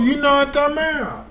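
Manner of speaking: ordinary talk, nothing marked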